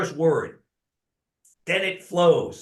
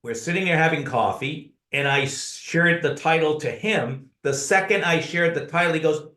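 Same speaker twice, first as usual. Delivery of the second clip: usual speaking voice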